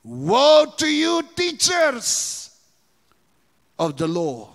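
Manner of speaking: slow, loud, high preaching of woe; preaching at full force. Acted. Preacher voicing Jesus rebuking the Pharisees, forceful. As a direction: thunder it at the hypocrites.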